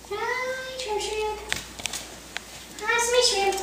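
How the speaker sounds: high soft coo for a little pet. Real, not acted. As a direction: coo sweetly to the tiny shrimp. Baby talk to pet.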